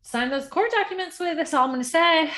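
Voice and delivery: high, loud, warning auntie. Acted. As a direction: scold like a knowing auntie. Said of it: "I don't know why I'm giving auntie voice right now".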